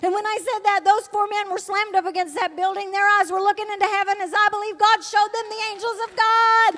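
high, loud, fervent testimony; preaching at full force. Acted. Preacher testifying to the church, loud and fervent. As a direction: proclaim it to the congregation.

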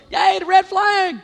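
mock cheerful. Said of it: "I'm just out there going".

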